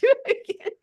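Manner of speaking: breaks into a high laugh. Real, not acted. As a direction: laugh the words out, delighted. Laughs at her own plunger story.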